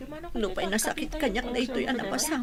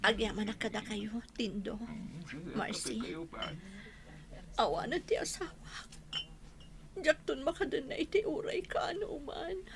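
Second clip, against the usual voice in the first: soft broken widow's voice; barely able to speak through grief; grieving whisper; 4 semitones lower, 11 dB quieter than usual. Acted. Grieving widow thanks visitors. (naladingit nga arasaas)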